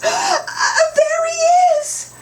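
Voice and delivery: very high, loud cry of relief; excited discovery cry. Acted. Kids spotting the fallen dump man, very high and loud. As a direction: shout it as you spot him. Kids find Mister Pottle.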